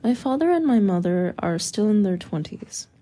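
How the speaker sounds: ordinary delivery, nothing marked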